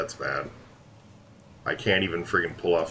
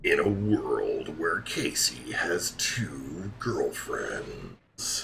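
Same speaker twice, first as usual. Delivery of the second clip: slow, hushed, dramatic trailer voice; milk each phrase like a hammed-up film trailer; drawn-out suspense voice-over; mock movie trailer narrator; slower than usual.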